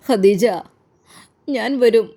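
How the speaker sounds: calling her name out loud through tears; grief-stricken cry. A narrator's reading. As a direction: cry out to her across the grave. Grieving husband calls at grave. (കണ്ണീരോടെ ഉറക്കെ വിളിച്ച്)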